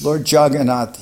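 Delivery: ordinary talk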